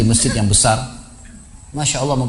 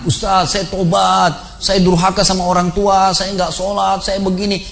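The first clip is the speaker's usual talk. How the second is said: higher, drawn-out mock-tearful plea; imitated trembling confession; 6 semitones higher, slower than usual. Shaken volunteer confessing sins to the Ustaz, imitated trembling plea. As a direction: imitate a shaken penitent, stretching the words.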